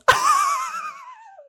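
very high, loud helpless shrieking laugh; cracking up uncontrollably; real laughing fit. Real, not acted. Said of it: "after laughing that hard".

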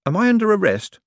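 high-pitched, timid question; small, high, sheepish voice; meek. A narrator's reading. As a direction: ask it meekly, expecting trouble. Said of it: "said Moist meekly".

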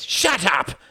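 high, loud, angry shout; crying out. A narrator's reading. Reginald cutting off Wendy's accusation, crying out. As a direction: shout her down. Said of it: "cried Reginald".

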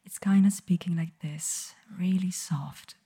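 breathy ASMR half-whisper, unhurried; soft speaking. Shown as a demonstration. ASMR fan showing friends the first type, soft whispery voice. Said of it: "the first one is soft speaking".

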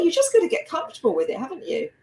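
ordinary talk

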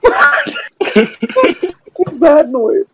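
laughing. Real, not acted. Laughing mid question.

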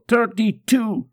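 high-pitched pained shout; sharp cry breaking into the prayer; agonised count. Acted. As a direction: cry the number out under the lash. Counting lashes during the scourging.

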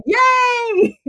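very high shouted yay; mock cheer. Parents celebrating the poop, high cheer. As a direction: cheer like over a potty win. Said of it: "they're just like".

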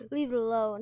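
loud, strained plea; choked out. Acted. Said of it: "Heavier choked out".

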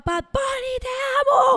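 más aguda, fan emocionada; grito de fan. Retold or imitated. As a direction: imita a una fan gritando. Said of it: "Hasta gritaba". (grito de fan emocionada)

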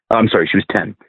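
normal talking voice

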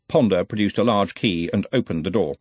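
ordinary delivery, nothing marked

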